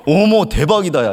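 high, loud, thrilled; mock amazed gush. Pastor acting the amazed reaction he did not give, mock thrill. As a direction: act thrilled at the news. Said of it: "이러지 않았어요".

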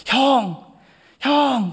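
high excited shout; yelling hoobae. Retold or imitated. Junior yelling for his older brother, high loud shout. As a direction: call out to your hyeong from far away. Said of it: "고래고래 소리를 지르더라고요".

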